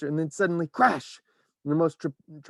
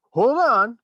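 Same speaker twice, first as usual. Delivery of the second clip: high, loud warning call; loud cry; 11 semitones higher, 10 dB louder than usual. A narrator's reading. Wonka warns passengers, loud high cry. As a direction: shout a warning to hold tight. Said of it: "cried Mister Wonka".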